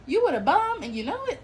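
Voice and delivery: high, loud teasing; playful taunt. Real, not acted. Mocking the woman.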